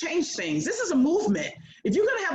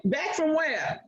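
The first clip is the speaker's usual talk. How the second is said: laughing retort; 5 semitones higher, slower than usual. Retold or imitated. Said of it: "And I'm like".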